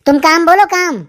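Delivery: normal talking voice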